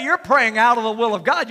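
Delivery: usual speaking voice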